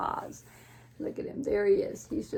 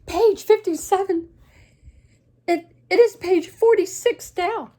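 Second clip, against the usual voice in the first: high, loud panic; frantic storybook yelling; Gerald; 10 semitones higher, 12 dB louder than usual. Acted. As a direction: blurt it out in alarm, voice way up. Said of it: "he panics".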